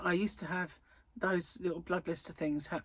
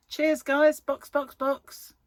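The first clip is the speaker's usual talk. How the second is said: playful call; 8 semitones higher, 7 dB louder, much slower than usual. Acted. Playful sign-off, F1 radio.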